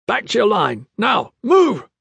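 high, urgent guard's shout; bellowed order. A narrator's reading. Blackguards echo the command to people inside, bellowing. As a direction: bellow short commands at a crowd. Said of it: "bellowing at the people inside".

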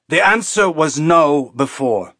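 lightly voiced captain, firm and slow; gruff refusing captain. A narrator's reading. Captain turns down comms request, firm refusal.

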